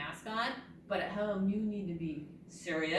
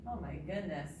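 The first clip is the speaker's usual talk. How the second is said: normal talking voice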